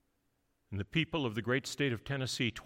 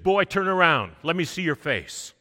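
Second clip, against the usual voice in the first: loud, higher command; booming command; 5 semitones higher, 8 dB louder than usual. Retold or imitated. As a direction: call him to turn around. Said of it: "big booming voice".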